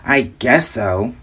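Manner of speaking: slow, put-upon "ugh" reply; imitated attitude. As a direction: mimic a grudging customer, dragging it out. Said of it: "gonna catch an attitude with me".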